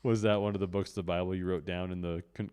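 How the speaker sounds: ordinary talk, nothing marked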